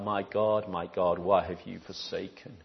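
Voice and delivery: low, soft anguished quote; a hushed echo of Jesus' cry. Acted. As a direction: let the cry fall almost to nothing.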